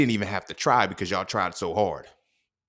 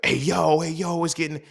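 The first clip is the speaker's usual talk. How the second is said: mock excited friend; 8 semitones higher, 4 dB louder than usual. Mocking friend's pause reaction.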